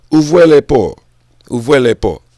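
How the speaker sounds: loud French-accented call. Shown as a demonstration. Showing open the gates in French, loud French accent. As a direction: call it out in French, twice, loudly. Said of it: "in French".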